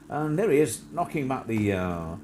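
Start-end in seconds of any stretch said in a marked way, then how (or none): none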